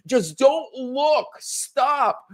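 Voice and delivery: put-on a touch of a begging teen; mock begging. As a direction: plead with dad to stop, lightly voiced. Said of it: "from begging to crying".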